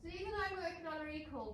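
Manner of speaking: ordinary delivery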